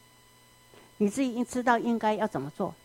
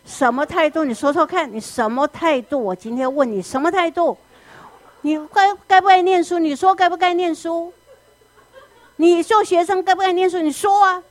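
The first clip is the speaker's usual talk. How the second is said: put-on louder, higher furious interrogation of a child; angry parent; 6 semitones higher, 5 dB louder than usual. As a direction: bark the questions at the kid, building. Parent scolding child over studying. (父母逼问孩子该不该念书，越来越生气地大声质问)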